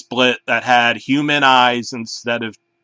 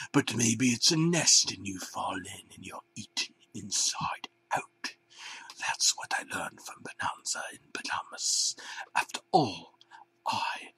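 softer, unvoiced eerie whisper; creepy whisper; 5 semitones lower, 11 dB quieter than usual. A narrator's reading. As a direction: murmur it like a strange prophecy. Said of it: "the head banana said something really weird".

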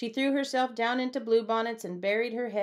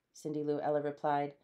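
usual speaking voice

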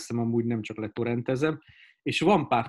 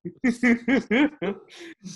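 high, loud burst of laughter; 11 semitones higher, 4 dB louder than usual. Real, not acted. Amused after the others' joking. (magas, hangos nevetés)

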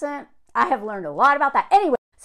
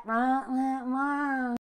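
lightly imitated Southern drawl on nonsense syllables; mock Southern drawl; 7 dB quieter, much slower than usual. Shown as a demonstration. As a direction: draw out a lazy Southern drawl. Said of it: "it's that southern drawl".

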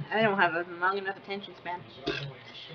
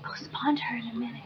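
unvoiced aside whisper; 2 semitones higher, 5 dB quieter than usual. Real, not acted. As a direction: hide it from the pet, whispered.

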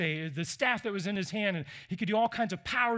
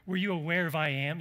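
usual speaking voice

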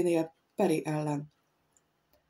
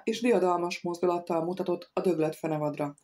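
usual speaking voice

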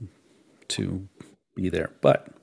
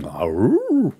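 short mock wolf howl; 7 semitones higher, 7 dB louder than usual. Shown as a demonstration. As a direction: howl like Wolfman Jack. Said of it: "That was a bit of a".

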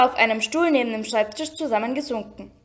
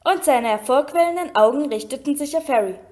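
usual speaking voice

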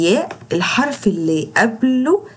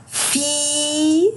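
slow فيل, higher, ee held; long drawn vowel; 6 semitones higher, much slower than usual. Shown as a demonstration. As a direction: stretch فيل. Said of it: "وبطول في صوته".

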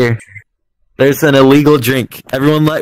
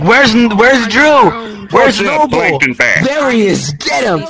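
mock crowd; 8 semitones higher, 4 dB louder, much slower than usual. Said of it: "everyone's like".

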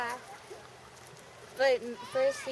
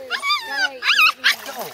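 mock terror; 12 dB louder than usual. Mock panic at sea monster.

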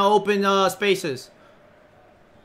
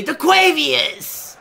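slow, stretched, higher call of the name; signature shout demo; 6 semitones higher, much slower than usual. Shown as a demonstration. Showing a teammate his signature name shout, slow and stretched. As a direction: drag the name out like a hype call. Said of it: "you gotta say".

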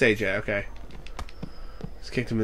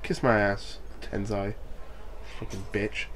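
muttered insults; 8 semitones lower, 14 dB quieter than usual. Real, not acted.

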